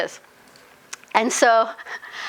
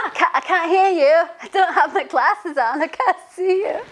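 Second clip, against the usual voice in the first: mock deaf old lady; 9 semitones higher than usual. Half deaf old woman replying.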